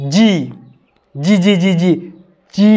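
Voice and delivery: "জি" repeated crisply; letter G demo. Shown as a demonstration. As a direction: drill "জি" for students. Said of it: "উচ্চারণটা করতে হবে". ("জি" বারবার স্পষ্ট করে)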